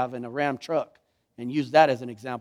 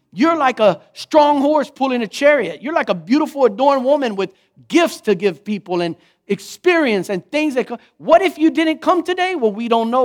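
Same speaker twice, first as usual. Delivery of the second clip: higher and louder, urgent sermon drive; fervent preaching; 7 semitones higher, 6 dB louder than usual. Acted. Preacher urges listeners they are needed, fervent. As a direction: exhort them with rising passion.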